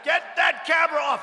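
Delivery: high, loud imitation of a boss yelling; mock screamed order. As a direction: scream the order at the cameraman, mimicking. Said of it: "They're screamed at".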